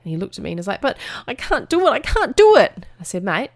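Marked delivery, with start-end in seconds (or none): 0.8-2.9 put-on high, whining child's protest; protesting little boy. Son to his mum on the hill, whiny protest. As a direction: wail it upward like a defeated kid.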